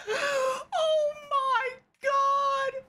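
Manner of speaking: very high, drawn-out wail through laughter; helpless laughing cry. Real, not acted. Listener floored by the sad dad story, laughing wail. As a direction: stretch it out, cracking up.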